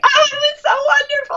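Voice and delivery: high, fast, laughing gush; overjoyed squeal, half crying. Retold or imitated. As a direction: gush it through tears of laughter. Gushing retold reaction, laughing.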